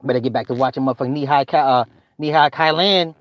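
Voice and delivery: ordinary talk, nothing marked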